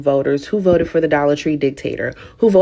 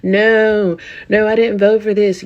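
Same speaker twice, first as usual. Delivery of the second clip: mock whiny voter; 5 semitones higher than usual. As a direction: whine it like a caught-out voter. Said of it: "So now they're saying".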